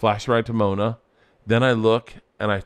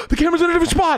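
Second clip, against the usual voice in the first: high, loud, breathless amazement; gasping excited kids; 3 dB louder, much faster than usual. Retold or imitated. Kids gasping at the footage, shrill with surprise. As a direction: squeal it like stunned kids.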